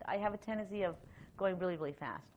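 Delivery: ordinary talk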